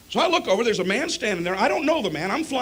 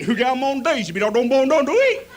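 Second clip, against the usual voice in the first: unintelligible Southern gibberish; 4 semitones higher, 6 dB louder than usual. Retold or imitated. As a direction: imitate an incomprehensible West Virginian. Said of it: "looking for a translator for the Northern Impaired".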